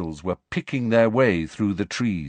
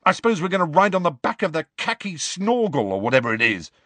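fast, higher sarcastic challenge; demanding; 9 semitones higher, faster than usual. A narrator's reading. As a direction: rattle it off with scorn. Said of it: "Ron demanded".

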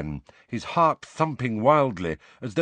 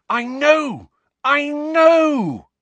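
loud, high triumphant shout; 8 dB louder, much slower than usual. A narrator's reading. Harry bursts out of the vision, shouting in triumph. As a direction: cry out in sudden discovery, far higher and louder. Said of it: "was triumphant".

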